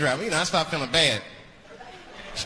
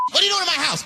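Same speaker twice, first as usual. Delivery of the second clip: much higher, louder confrontation; angry shout; 12 semitones higher, 4 dB louder, faster than usual. Acted. Tenant catching the landlord inside, high loud yell. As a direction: yell it at the intruder.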